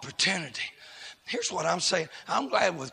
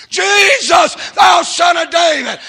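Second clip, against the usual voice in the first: loud, high shout to Jesus; crying out; 18 dB louder, slower than usual. Acted. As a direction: blind beggar crying out over a crowd. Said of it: "He cried out the more".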